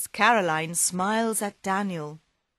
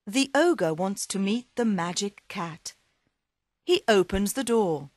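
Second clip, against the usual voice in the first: ordinary delivery, nothing marked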